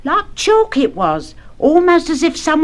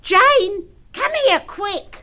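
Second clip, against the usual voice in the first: alarmed; 8 semitones higher, much slower than usual. Acted. Alarmed mother calls daughter.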